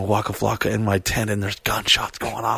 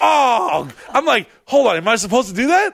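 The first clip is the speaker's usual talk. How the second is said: startled; 13 semitones higher than usual. Retold or imitated. Startled inner thought during meditation.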